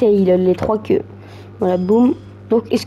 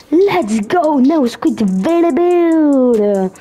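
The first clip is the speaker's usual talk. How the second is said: hype callout; 4 semitones higher, much slower than usual. Real, not acted. Hyped English callout, drawn out.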